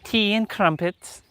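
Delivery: a light put-on British accent; mock British accent. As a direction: ham up a British accent on tea and crumpets. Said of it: "tap into the British accent".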